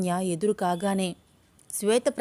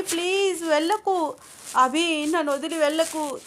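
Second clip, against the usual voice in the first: shouting desperately; 6 semitones higher than usual. Acted. Shweta shouting after Abhi to take her, building to high cries. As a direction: yell after him, rising to begging. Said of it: "గట్టి గట్టిగా అరుస్తోంది".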